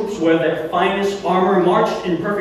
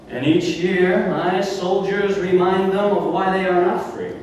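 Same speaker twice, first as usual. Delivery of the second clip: ordinary talk, nothing marked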